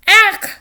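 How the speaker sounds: loud, high, grossed-out "Ach"; disgusted "ach". A narrator's reading. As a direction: spit out a sickened ach. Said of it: "Martha said, her stomach turning".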